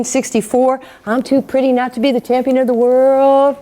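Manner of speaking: boastful champion's patter, imitated; Muhammad Ali. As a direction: brag like the champ in a rolling chant. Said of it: "Muhammad Ali".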